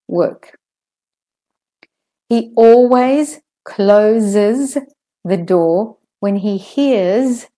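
careful model sentences, z in "husband", "closes", "music"; word by word, buzzing "always", "runs", "his"; teacher's deliberate reading, voiced z in "finishes" and "hears"; clear Z's. Shown as a demonstration. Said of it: "saying the Z's properly".

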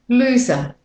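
British-Australian word demo; British-Australian accent. Shown as a demonstration. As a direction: drop the R of "loser", British-Australian style. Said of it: "And with the American accent".

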